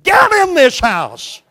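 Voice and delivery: loud, high, slow stern father calling; mock sweet call. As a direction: bellow it as a fake-sweet warning. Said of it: "very sweetly you say".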